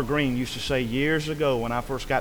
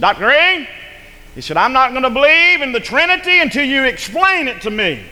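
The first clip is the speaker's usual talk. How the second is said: demanding challenge; 10 semitones higher, 8 dB louder than usual. Retold or imitated. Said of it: "a man come up to him".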